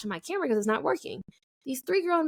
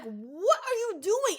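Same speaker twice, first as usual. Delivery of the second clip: high, slow disbelief; incredulous challenge; 9 semitones higher, slower than usual. Retold or imitated. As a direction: draw it out high, retelling your outrage. Retold challenge to pushy men.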